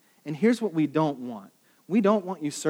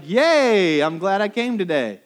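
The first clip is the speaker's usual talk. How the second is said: mock cheer, higher and louder; sarcastic yay; 4 semitones higher, 5 dB louder, slower than usual. Grudging team member pretending to be happy, sarcastic. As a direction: fake enthusiasm from a reluctant teammate. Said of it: "It's, like, awesome".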